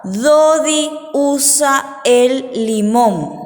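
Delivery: slow, drawn-out words; dictation. Shown as a demonstration. Teacher dictates sentence two to children, slow and stretched. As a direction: dictate it slowly, word by word. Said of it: "El número dos les dicto".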